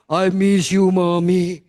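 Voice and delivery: loud, drawn-out man's cry; put-on loud male voice; grown man's voice. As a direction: imitate a big son hugging his mother. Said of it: "suaranya kayak om-om".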